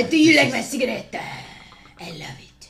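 a hint of suave French charm, quick; French duck accent. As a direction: purr it like a smooth Frenchman. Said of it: "a Frenchman named Philippe".

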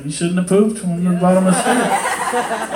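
slow, laughing defense; laughing dad. Retold or imitated. Dad answering mom's scolding, slow laughing. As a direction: justify it with a grin. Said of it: "The dad's like".

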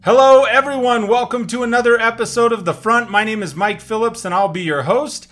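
loud, high, upbeat show opener; hyped host intro. Acted. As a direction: greet the audience like a TV host. Show host opening greeting.

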